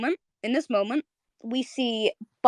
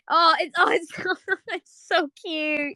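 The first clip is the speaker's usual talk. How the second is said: gushing; 8 semitones higher, slower than usual. Real, not acted. Gushing over the cute scene.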